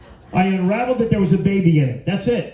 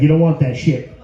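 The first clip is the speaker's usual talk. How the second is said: usual speaking voice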